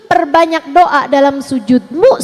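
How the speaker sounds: normal talking voice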